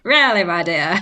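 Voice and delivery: grand mock-posh exclamation; mid-Atlantic accent. Joining the mid-Atlantic bit.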